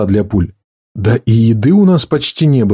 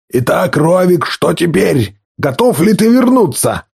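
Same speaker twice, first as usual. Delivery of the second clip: higher, taunting chief voice; mocking chieftain; 5 semitones higher than usual. A narrator's reading. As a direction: taunt him from above. Gyuzan confronts Rovik. (насмешливый вождь)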